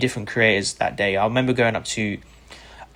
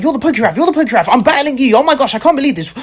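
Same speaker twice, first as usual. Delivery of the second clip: high, louder, breathless fan gushing; imitating a starstruck fan; 8 dB louder, faster than usual. Opponent freaking out on seeing the creator badge, high excited gushing. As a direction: gush in disbelief at meeting your idol. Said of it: "he started freaking out".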